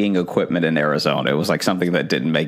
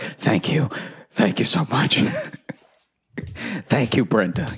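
hammed-up gratitude; 5 semitones higher than usual. Thanking the bank rep.

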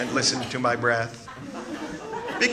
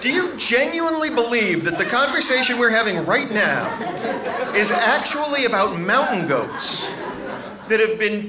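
much higher and louder, fed-up disbelief; exasperated; 9 semitones higher, 5 dB louder than usual. Retold or imitated. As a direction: blow up at mom, losing patience. Exasperated son retorts to his mother.

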